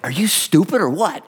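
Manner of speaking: a pastor scolding, half-joking; mock scolding.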